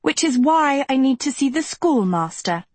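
high, prim and insistent demand; above the cattiness. A narrator's reading. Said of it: "trying to stay above their cattiness".

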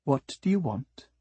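softly purred question; purring cat. A narrator's reading. Cat questioning the flower, a light purr. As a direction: purr it, understated. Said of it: "she purred".

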